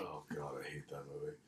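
whispered aside; whispering. Real, not acted. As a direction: breathe it out under your breath. Aside about movie.

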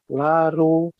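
ordinary talk, nothing marked

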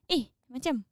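fast, clipped Singlish with a laugh; quick Singlish. Retold or imitated. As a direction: toss it off Singlish-style. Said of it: "Instead, okay, then".

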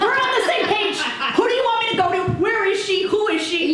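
high, loud, laughing eagerness; eager relief. Retold or imitated. Patient thrilled her therapist wants medication too. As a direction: blurt it out thrilled. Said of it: "I'm like".

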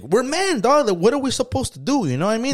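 incredulous mock protest; high-pitched protest. Arguing back against being called machistas.

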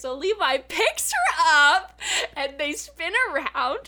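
high, loud, stretched-out excitement; giddy romantic build-up; gushing. Real, not acted. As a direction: swoon it out slowly and loudly. Gushing over romantic moment.

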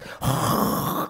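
breath blown out as wind; voiceless rush of air; imitated wind. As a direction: blow like wind through a fire.

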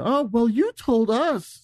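high-pitched mock-innocent questioning; high sly interviewer. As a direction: ask with fake sweet innocence.

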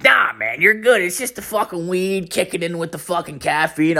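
high put-on buddy voice; laid-back friend. Friend reassuring the stoned comic, high put-on voice.

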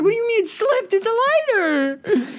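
very high, loud incredulous squeal; squeaky outrage. Retold or imitated. First-timer yelling at friend who claims the lighter slipped, squeaky outrage. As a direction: squeal it in disbelief.

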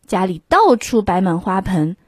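usual speaking voice

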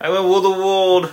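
loud grand villain declaration; megalomaniac rant. Pretend. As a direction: boom it like a cartoon tyrant. Mock villain boast.

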